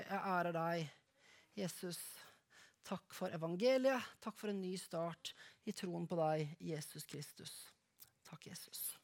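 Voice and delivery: soft, hushed closing prayer; fading to a whispered thanks. Acted. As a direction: pray quietly before the congregation.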